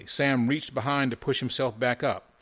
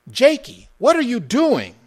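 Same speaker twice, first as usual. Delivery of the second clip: high, loud call to stop a friend; alarmed appeal to friend; 13 semitones higher, 11 dB louder than usual. A narrator's reading. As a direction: cry out in alarm at what your friend does. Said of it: "attempting to soothe his friend".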